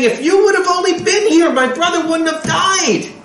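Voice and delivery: mourning sister to Yeshua, high and pleading; grieving sister. Acted. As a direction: reproach him through grief.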